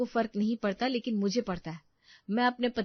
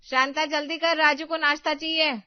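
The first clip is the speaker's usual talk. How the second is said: loud, higher bossy call to the daughter-in-law; 8 semitones higher, 6 dB louder than usual. Acted. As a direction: shout the order across the house. Mother-in-law calls across house. (सास का ऊँची आवाज़ में हुक्म)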